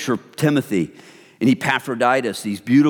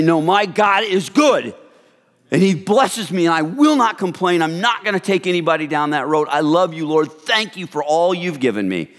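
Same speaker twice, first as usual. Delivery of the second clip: fervent declaration; 5 semitones higher than usual. Acted. Preacher declaring refusal to complain.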